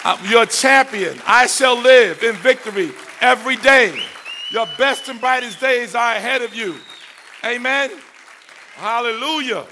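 high, ringing sermon close; preached declaration. Real, not acted. Preacher closing with promise of victory, ringing declaration. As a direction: proclaim it phrase by phrase.

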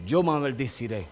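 voice of God. Retold or imitated. God speaking his promise to his people, low and solemn. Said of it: "Dios dijo".

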